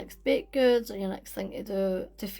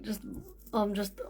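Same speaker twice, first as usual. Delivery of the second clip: normal talking voice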